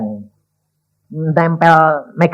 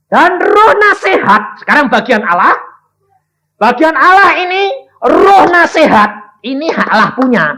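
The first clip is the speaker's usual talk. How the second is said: fiery preaching; 12 semitones higher, 7 dB louder than usual. Acted. Preacher crying out to the church about understanding. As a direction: preach it out to the congregation.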